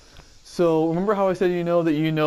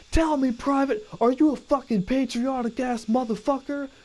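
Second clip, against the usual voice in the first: drill sergeant; 10 semitones higher than usual. Retold or imitated. Drill sergeant yelling at recruit.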